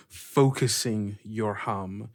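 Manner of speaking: ordinary talk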